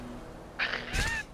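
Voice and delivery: shrill giggle burst; high squeaky laugh. Real, not acted. Laughs at the radio voice.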